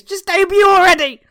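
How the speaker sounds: loud frustrated yell; exasperated shout. Real, not acted. Exasperated outburst.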